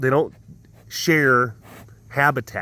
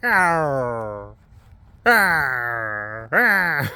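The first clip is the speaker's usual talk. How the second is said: imitated tiger call; 2 semitones lower, much slower than usual. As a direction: growl "arr" like a tiger. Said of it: "that's kind of what it sounds like".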